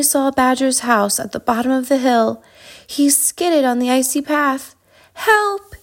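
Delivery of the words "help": high-pitched storybook yelp; cry for help. A narrator's reading. Hedgehog skidding on the icy path, crying for help. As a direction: cry out without a full shout. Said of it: "he cried".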